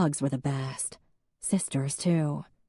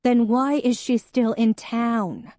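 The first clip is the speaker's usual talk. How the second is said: slow, high and louder; angry demand; 8 semitones higher, 5 dB louder than usual. Acted. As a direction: drag it out, pitched up in accusation. Em pressing Jack in argument.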